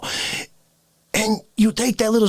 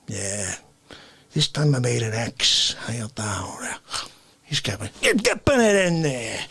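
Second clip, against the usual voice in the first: ordinary talk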